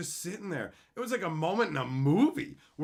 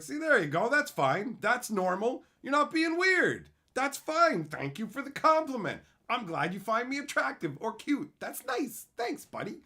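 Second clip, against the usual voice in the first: mock sweet approval; 10 semitones higher, 5 dB louder than usual. Mock approving reply to fan.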